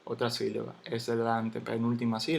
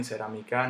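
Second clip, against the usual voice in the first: cerámica stressed on CA, cerami-CÁ; wrong final stress. Shown as a demonstration. Teacher rejecting a wrong version, cerámica stressed on final CA. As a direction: push cerámica's stress to the end. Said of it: "Yo no digo cerámica".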